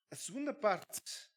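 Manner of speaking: ordinary talk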